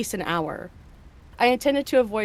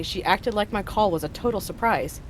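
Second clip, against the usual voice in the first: ordinary talk, nothing marked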